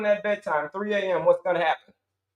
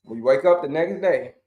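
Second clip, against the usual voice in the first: usual speaking voice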